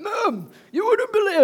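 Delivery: very high, loud whine to mum; whiny complaint. Retold or imitated. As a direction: wail it to your mum. Telling mum about work.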